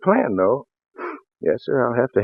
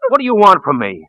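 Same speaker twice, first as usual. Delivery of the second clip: menacing demand; 7 semitones higher, 7 dB louder than usual. Acted. Man menaces frightened woman.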